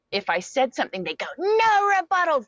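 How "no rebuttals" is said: screamed much higher and louder, far from her own voice; group scream. Retold or imitated. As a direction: yell it like the whole group at once. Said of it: "the whole group would just scream it".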